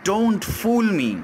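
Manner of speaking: ordinary talk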